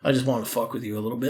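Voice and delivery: soft, low, casual aside; dropping the act. Retold or imitated. Veteran calming the kid on the bar stool, low and offhand. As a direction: let the mock rage fall away, low and quiet.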